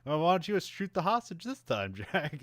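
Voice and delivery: lightly mocking villain, pitched high; taunting villain. Gloating villain taunts Jack over a hostage, mock taunting. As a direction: tease with a high, chuckling imitation of a villain. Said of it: "[name] comes out laughing".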